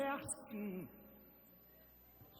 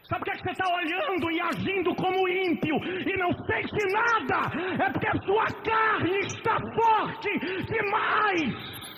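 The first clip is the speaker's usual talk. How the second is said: shouted sermon, high and loud; fiery pulpit shout; preaching at full force; 8 semitones higher, 9 dB louder than usual. Acted. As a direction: thunder it at the church.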